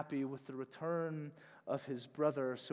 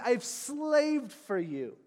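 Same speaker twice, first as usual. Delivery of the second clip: bitter complaint; 8 semitones higher, 5 dB louder, slower than usual. Acted. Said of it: "Other translations say".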